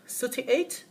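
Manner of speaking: high, rising "Thirty-eight?" to make sure; checking rise. Shown as a demonstration. Teacher confirming a street number, rising question. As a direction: lift the end to check. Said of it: "you just want to make sure".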